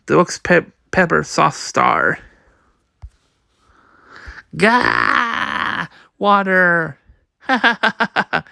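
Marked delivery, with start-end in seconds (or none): 4.6-5.9 a sudden yelped cry of pain; hammed-up pained shout. Character burns from pepper sauce. 7.2-8.4 a giddy laugh into the words. Acted. Villain gloats.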